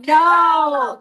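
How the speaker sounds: loud, high, emphatic no; resounding no. Shown as a demonstration. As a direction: shout the no for the room to follow. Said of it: "I wanna hear a resounding no".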